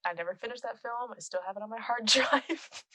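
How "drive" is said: ordinary talk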